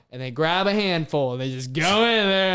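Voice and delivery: normal talking voice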